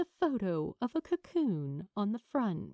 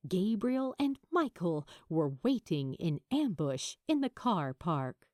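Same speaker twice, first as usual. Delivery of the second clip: plain reading voice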